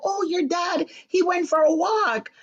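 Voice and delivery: lightly voiced high, polite lady; mock sweet lady voice. Stepmother's helper greets the visiting son, mock sweet and high. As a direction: chirp it like a nice hostess.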